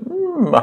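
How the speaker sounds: very high pretend crying whine; mock whimper. Shown as a demonstration. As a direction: whimper briefly to act out crying. Mime le verbe pleurer. (petit gémissement de faux pleurs)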